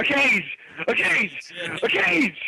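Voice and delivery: high frantic chant rising each time; Ric Flair yelling. Retold or imitated. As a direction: shriek it like a wrestler flipping out. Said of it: "And then he says".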